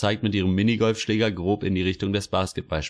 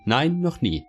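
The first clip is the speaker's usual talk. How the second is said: higher-pitched, lightly voiced official; a hint of an eager little bureaucrat; official's imagined answer; 8 semitones higher than usual. A narrator's reading. The official answering Greta's unasked question, lightly voiced. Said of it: "wäre die Antwort des Beamten".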